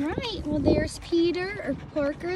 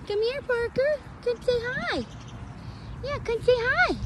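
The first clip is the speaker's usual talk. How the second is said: much higher, light sing-song coaxing; coaxing pet call; 11 semitones higher than usual. Real, not acted. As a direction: sweetly coax the animal closer. Coaxing Parker to come.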